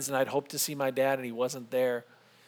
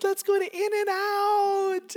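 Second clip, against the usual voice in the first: put-on high, drawn-out little-girl cheer; cheering child; much slower than usual. Daughter picking the lunch spot, cheering. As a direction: chant it out long and very high, like an excited kid. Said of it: "Rachel cheers".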